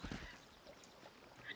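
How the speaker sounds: laughing. Real, not acted. Laughing at own joke.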